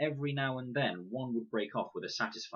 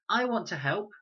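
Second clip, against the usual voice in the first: put-on high, loud little boy; Max, eager; 13 semitones higher, 6 dB louder than usual. A narrator's reading. Max offering to help, loud high child. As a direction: chirp it like a keen small boy. Max's voice in read story.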